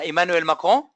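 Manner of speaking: a careful try at French accent on Emmanuel Macron. Shown as a demonstration. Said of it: "I'll try to say it with the French ac-, accent".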